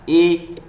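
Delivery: loud stretched ए; drawn-out eh. Shown as a demonstration. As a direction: stretch ए wide with the tongue out. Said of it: "जब भी आप बोलोगे word को". (खींचकर बोला गया ए)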